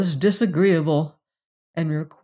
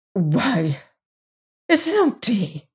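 chagrined; 4 semitones higher, slower than usual. A narrator's reading. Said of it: "he said aloud in a chagrined tone".

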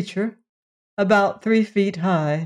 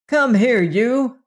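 sharp, raised call after a runaway; angry call; 5 semitones higher, 3 dB louder, slower than usual. Acted. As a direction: snap it out, chasing him in. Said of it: "followed by an angry Stebbins".

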